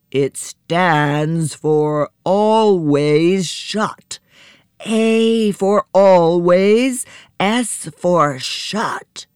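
very slow, drawn-out giant voice; ponderous giant proclamation; giant's protest. Acted. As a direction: stretch every word like a huge giant forbidding entry. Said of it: "giant voice protested".

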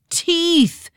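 high triumphant exclamation; exclaiming. A narrator's reading. Twins solving the riddle, exclaiming the answer. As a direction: shout the answer in sudden triumph. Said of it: "they exclaimed simultaneously".

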